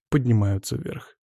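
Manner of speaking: ordinary delivery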